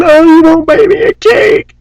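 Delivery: high fake sob, whining; hammed-up crying whine; mock whiny crybaby. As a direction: wail like a spoiled child. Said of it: "stamp our feet and sue and scream".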